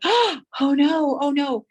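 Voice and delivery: high, loud "oh no" from a shocked crowd; a crowd of spectators watching the runner fall, high and loud dismay; crowd gasping in dismay. Retold or imitated. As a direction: gasp it out like a stunned audience. Crowd reacts to boy falling.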